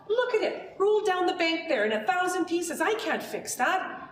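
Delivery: hammed-up dismay over the shattered pot; upset outburst. A narrator's reading. Washerman to the boy, pointing at the smashed pot, in a high upset outburst. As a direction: pitch shoots up, then slows on the last words.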